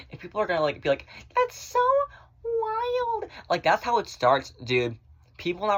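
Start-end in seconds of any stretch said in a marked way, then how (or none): 1.4-3.3 squeaky high mock voice, drawn out; mocking falsetto. Mocking what people say, in high falsetto. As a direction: parody someone gushing in falsetto.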